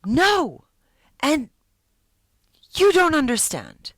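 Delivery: high, loud desperate shout. Acted. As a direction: yell it back with everything.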